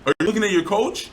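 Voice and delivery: loud, high-pitched indignant questioning; pissed off. Real, not acted. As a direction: demand an answer, really fed up. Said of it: "That's why I'm getting pissed".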